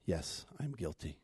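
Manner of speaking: very low, soft admission; hushed confession. Acted. David finally faced with the truth, hushed confession. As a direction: own up quietly, voice sunk.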